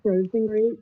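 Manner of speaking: muffled voice, like through a wall; muffled audio. Real, not acted. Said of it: "You sound really muffled".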